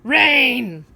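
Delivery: loud, high grumpy grumble; grumpy old man. A narrator's reading. Grumpy old man complaining about the rain, loud and gruff. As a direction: bark it like a cranky old man. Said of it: "says the grumpy old man".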